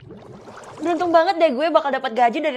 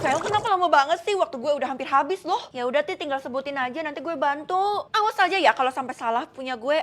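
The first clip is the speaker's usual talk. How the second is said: ordinary delivery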